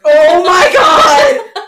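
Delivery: slow shocked outcry, loud and high. Real, not acted. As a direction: gasp it out in disbelief. Shocked at the matchup.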